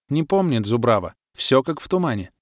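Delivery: ordinary delivery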